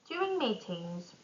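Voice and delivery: ordinary talk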